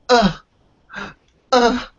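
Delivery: high mock effortful grunting; imitating a woman hauling a buggy up the stairs; mock straining grunts. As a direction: strain like pulling a buggy up steps. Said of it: "she was going".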